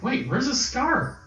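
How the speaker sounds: put-on high, indignant kid voice; high, whiny kid nitpicking; imitating a kid. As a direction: complain like a picky kid. Twin notices the missing scar.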